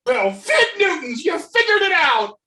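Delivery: high, loud unhinged glee; manic villain. Acted. Unmasked Ted gloats at his captors, manic glee. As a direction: crow it like a cornered madman.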